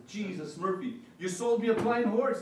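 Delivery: a cheated buyer blowing up; pissed off. Retold or imitated. Buyer back the next day to confront the farmer, furious. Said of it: "he's all pissed off".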